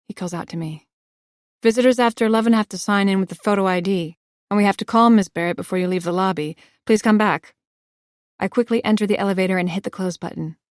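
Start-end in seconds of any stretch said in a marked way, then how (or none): 1.6-7.6 louder, firm call across the lobby. Acted. Doorman calling after the visitor, loud and firm. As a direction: call her back, insistent.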